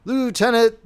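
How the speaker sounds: sharp, stern rebuke; severe. A narrator's reading. Colonel checking the lieutenant, severe. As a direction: cut him off with severity. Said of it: "began the colonel severely".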